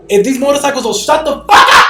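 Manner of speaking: yelling at the loud motorcycles outside; angry shout. Real, not acted. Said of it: "I'm trying to fucking reenact this".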